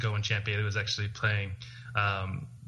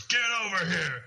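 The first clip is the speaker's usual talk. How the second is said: sharp high shout, pitched up; 8 semitones higher than usual. Retold or imitated. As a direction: yell it across the sideline. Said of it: "you can see Jack Del Rio mouth the words".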